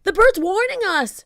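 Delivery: high, loud pirate cry; alarmed shout. A narrator's reading. Pinky warning the crew, shouting. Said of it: "shouted Pinky".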